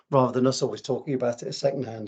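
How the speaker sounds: usual speaking voice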